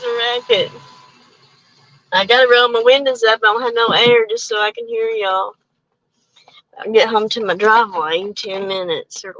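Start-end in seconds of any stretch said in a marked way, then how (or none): none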